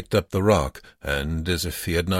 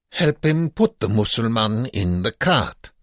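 put-on raised, high accented voice; commanding guard; 6 semitones higher, 4 dB louder than usual. A narrator's reading. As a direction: order the prisoner about as a camp guard. Guard gives order to prisoner.

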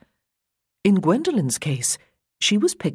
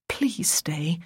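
whispered plea; 4 dB quieter than usual. Acted. Girl's silent plea.

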